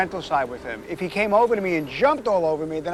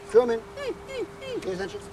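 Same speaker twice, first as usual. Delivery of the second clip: calling the dog; 6 dB quieter than usual. Real, not acted. Calling the dog's attention.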